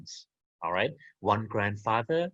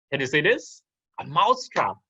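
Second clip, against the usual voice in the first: ordinary talk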